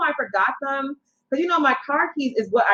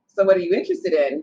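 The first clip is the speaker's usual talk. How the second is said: ordinary delivery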